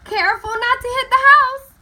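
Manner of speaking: high, loud call across the yard; father's yell. A narrator's reading. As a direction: yell a warning up to her. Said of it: "Violet's father would yell".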